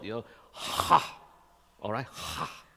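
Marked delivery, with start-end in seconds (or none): 0.5-1.2 "ha" low from the throat, breathy; throaty ha. Shown as a demonstration. Preacher showing the congregation the throaty Hebrew H. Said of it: "the Hebrew language is from the throat". 2.1-2.6 unvoiced "Ha." pure breath from the throat; just breathe "Ha." from the throat; whispered throaty ha. Shown as a demonstration. Preacher breathing the Hebrew H with no voice. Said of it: "from the throat".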